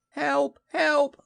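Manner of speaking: very high, squeaky cries for help; high panicked yelps; Piglet crying out. Acted. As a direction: squeal for help like a tiny frightened animal. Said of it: "cried Piglet".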